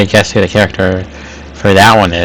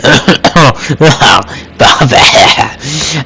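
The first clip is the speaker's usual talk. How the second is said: dramatic cough; 4 semitones higher than usual. Acted. Hugo fakes an allergic fit in the restaurant, hammed-up coughing. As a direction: fake a big choking fit. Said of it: "He coughs dramatically".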